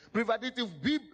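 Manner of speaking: ordinary delivery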